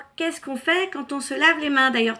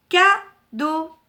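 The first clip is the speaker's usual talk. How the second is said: "Cadeau" in two higher, louder, separated beats; syllable by syllable; 6 semitones higher, 8 dB louder than usual. Shown as a demonstration. Teacher joins letter and picture for children, syllable by syllable. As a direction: pause between ca and deau in "Cadeau". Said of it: "composé de deux syllabes".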